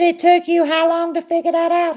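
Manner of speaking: incredulous. Retold or imitated. Retelling teasing husband.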